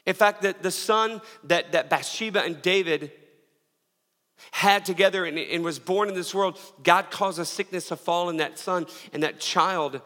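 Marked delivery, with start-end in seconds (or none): none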